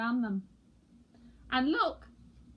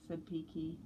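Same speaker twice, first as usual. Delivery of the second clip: plain reading voice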